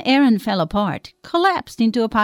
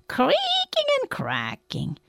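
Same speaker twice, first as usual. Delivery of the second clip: very high, slow creaky rendering; high, stretched creaking voice; creaking bones; 5 dB quieter, slower than usual. A narrator's reading. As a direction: make the words creak like old joints.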